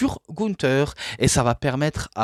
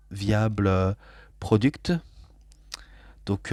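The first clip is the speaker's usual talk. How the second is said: ordinary delivery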